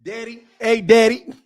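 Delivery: "daddy" said as "deddy"; "daddy" rhyming with "ready"; Chicago pronunciation. Shown as a demonstration. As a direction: call out "daddy" with an eh vowel. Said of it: "it is pronounced daddy".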